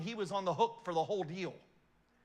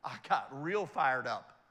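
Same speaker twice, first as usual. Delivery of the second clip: ordinary talk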